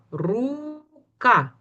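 Рука with the У held long, then КА set apart; drawn-out syllables. Shown as a demonstration. Teacher saying the word with the class, syllable by syllable. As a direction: stretch the first syllable of Рука. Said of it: "Давайте скажемо слово рука".

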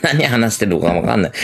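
ordinary talk, nothing marked

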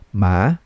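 "ma" starting low and rising; second tone. Shown as a demonstration. Teacher drilling learners in second tone, "ma" rising. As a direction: glide "ma" upward from low. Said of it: "One more time".

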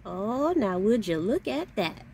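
normal talking voice

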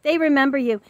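normal talking voice

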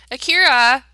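loud call across the room. Real, not acted. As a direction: call his name out loud. Calling Sim across house.

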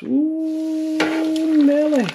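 high delighted Ooh Nelly; thrilled, pitch jumps up; excited exclamation. Real, not acted. Excited about fish in oil.

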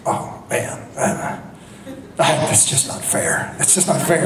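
soft, near-whispered and wistful; hushed longing mutter. Retold or imitated. Producer quietly pining over the actress across the party, hushed mutter. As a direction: mutter it under your breath like he's smitten.

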